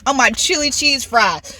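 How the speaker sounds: ordinary delivery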